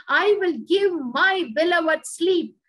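ordinary talk

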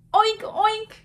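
high, loud playful oinks; pig oinking. A narrator's reading. Teacher voicing the pig for children, high loud oinking. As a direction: oink it out like a storybook pig. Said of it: "grunted the pig".

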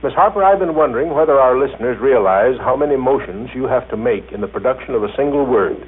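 normal talking voice

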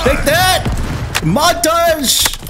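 high, excited battle call; high-pitched, gleeful exclamation; triumphant gamer cry. Real, not acted. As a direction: crow over a kill. Celebrating a hit in game.

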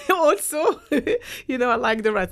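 high, loud laughter through the words; laughing. Real, not acted. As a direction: laugh out the answer about rats. Laughs at the big rats joke.

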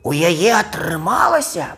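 high, astonished crow; amazed stage whisper. Acted. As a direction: gasp it out in disbelief. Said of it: "прашаптаў ён". (здзіўлены шэпт напаказ)

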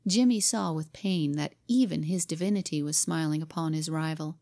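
ordinary delivery

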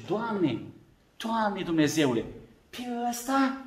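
soft, disbelieving plea upward; can this really be him; astonished Samuel. Acted. Samuel sees little David, hushed disbelief.

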